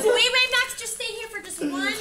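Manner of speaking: hurried big sister. Acted. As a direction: call out to her little brother in a rush. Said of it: "And she goes".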